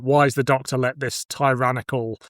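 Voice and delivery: ordinary talk, nothing marked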